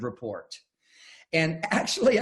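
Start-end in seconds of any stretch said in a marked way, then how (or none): none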